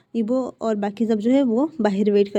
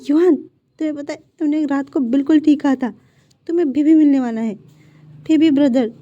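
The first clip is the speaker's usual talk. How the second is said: higher, louder overjoyed announcement; father tells his son a baby brother is coming, overjoyed; joyful announcement; 4 semitones higher, 6 dB louder than usual. Acted. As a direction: burst with joy as you tell the boy. Father hugs son, joyful news.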